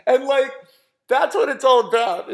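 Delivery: ordinary delivery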